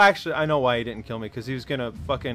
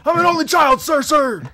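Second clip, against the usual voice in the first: shouting recruit; 13 semitones higher, 10 dB louder than usual. Pretend. Recruit answering drill sergeant.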